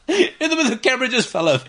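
laughing through the words; can barely get it out for laughing. Real, not acted. Retelling funny camera mishap.

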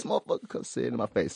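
soft, whispery mock challenge; hushed repeat. Host winding down from an outburst, hushed. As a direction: drop it right down under the breath.

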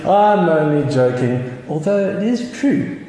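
lightly voiced villain, slow then rising high; a hint of a sly super villain; mock villain joke. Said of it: "he starts laughing, and he says".

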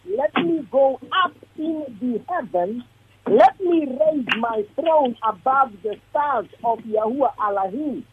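usual speaking voice

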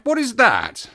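sudden high startled question; startled cry. A narrator's reading. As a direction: call out in alarm. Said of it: "I cried".

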